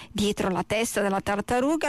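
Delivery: ordinary delivery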